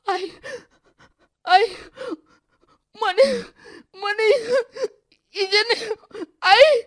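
high, loud, halting words between sobs; broken sobbing. Acted. Daughter sobs brokenly to her mother, high and loud. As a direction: cry out to your mother through hiccuping sobs. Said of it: "તૂટક તૂટક અવાજે બોલતી હતી".

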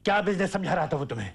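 fast question at full voice; sharp demand. Acted. Father demanding an answer from his son, sharp and rapid.

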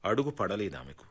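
usual speaking voice

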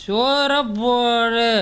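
long, dragged-out order for food; slow, insistent bellyaching demand; drawn-out demand. Acted. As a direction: stretch each word out, demanding. Drunk son demands food.

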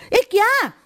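high, loud burst of surprise; loud startled question. A narrator's reading. Narrator startled by her friend's plan, loud and high. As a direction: blurt it out, shocked. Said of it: "હું જોરથી બોલી ગઈ".

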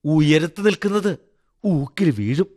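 high, emphatic warning; harsh tone. A narrator's reading. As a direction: speak as an embittered wife pronouncing doom. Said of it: "ഇത്രയും കടുത്ത സ്വരത്തിൽ".

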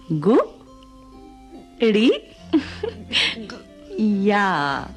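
Gudiya split into three syllables, long pauses between. Acted. Teacher writes on Helen's hand, Gudiya said syllable by syllable. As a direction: say Gudiya very slowly in separate pieces, last one drawn out.

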